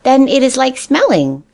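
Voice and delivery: put-on high, eager childlike voice; a baby bunny sure he's guessed it; eager little rabbit. A narrator's reading.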